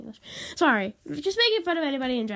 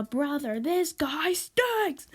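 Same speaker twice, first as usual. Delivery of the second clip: higher, drawn-out complaint; exasperated gripe; 5 semitones higher, much slower than usual. Real, not acted. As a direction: whine it out, stretching the last word. Mock-griping about brother.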